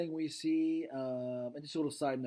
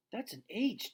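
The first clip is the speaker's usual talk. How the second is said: put-on much higher young daughter insisting; girl's voice; 9 semitones higher than usual. Julia urges her dad to look, high girl voice. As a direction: pitch up into a girl pleading.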